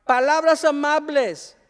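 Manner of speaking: high, loud eager little voice; a kid reciting the right answer; child's answer. Acted.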